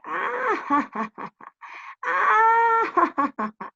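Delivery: high "aah" breaking into ha-ha-ha; acted, staccato peals of laughter; mocking storybook laugh. Shepherd laughs at the fooled villagers, hammed-up laughter. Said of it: "se echó a reír y decía".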